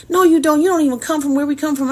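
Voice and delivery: mimicking the boy's insistent denial; defiant kid retort. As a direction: snap back like a stubborn kid. Boy arguing he's underprivileged.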